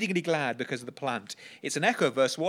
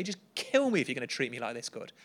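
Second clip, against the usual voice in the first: ordinary delivery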